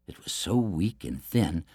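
ordinary delivery

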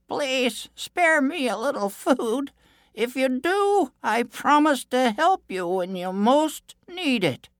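high, drawn-out creature voice; creature calling out. A narrator's reading. Starving creature begs the prince for food, calling out high. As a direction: plead in a high, slow little voice. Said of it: "it called to him".

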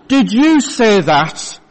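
ordinary talk

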